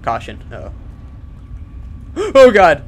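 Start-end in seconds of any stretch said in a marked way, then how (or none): 0.8-2.3 high frightened gasp. Real, not acted. Ambushed in a scary vault. 2.4-2.8 loud high panicked outburst; panicked cry. Real, not acted. Ambushed in a scary vault.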